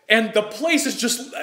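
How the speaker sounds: ordinary delivery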